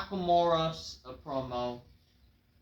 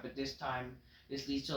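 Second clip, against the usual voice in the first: ordinary talk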